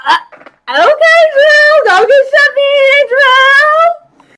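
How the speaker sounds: high, loud teasing hype; teasing cheer. Real, not acted. Viewer hyping up Drew's flirting with Maniac, high and loud. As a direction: egg her on like a friend.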